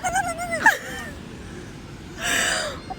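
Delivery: high-pitched helpless giggle. Real, not acted. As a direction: break into giggles. Reacting to bark joke.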